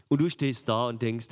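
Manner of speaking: ordinary talk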